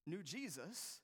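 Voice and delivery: ordinary delivery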